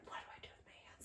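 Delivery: fast, breathless whisper. Retold or imitated. Bride recalling awkward moment at altar, quick whisper. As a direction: whisper it like a secret panic. Retold panicked thought during vows.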